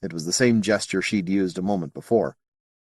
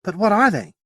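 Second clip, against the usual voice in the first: a pressing, raised demand; 11 semitones higher than usual. Acted. Sally demanding the alien explain the creatures. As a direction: insist on an answer now. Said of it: "Sally demanded".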